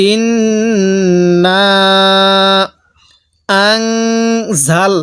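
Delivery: slow drawn-out chanted recitation; Quran recitation. Shown as a demonstration. Teacher recites verse slowly for students to copy. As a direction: stretch ইন্না and আনজালনাহু slowly. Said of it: "কিভাবে পড়ব?".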